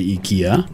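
ikea as ee-KEE-uh, starting with "ee"; slow ikea with "ee" for the first vowel; Swedish pronunciation. Shown as a demonstration. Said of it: "if you're Swedish, you'd say".

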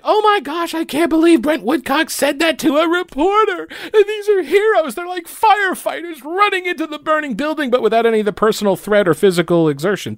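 high, hammed-up teary whine; mock outrage. Reporters gasping at the lawyer, high mock-teary whine. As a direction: play the reporters as fainting melodrama.

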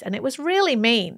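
usual speaking voice

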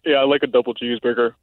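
low, quick dude at the drive-thru; male voice. Shown as a demonstration. Woman fooling the drive-thru as a customer, male voice. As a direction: drop into a man's voice. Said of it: "that can talk with the male voice".

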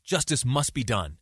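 ordinary talk, nothing marked

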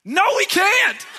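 very high, loud, drawn-out mock wail; exasperated outburst. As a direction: explode comically at the suggestion. Acted outburst back at the child.

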